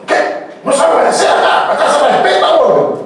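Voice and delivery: high-pitched hammed-up dad outburst; angry father. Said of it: "El padre". (padre enojado, regaño agudo y exagerado)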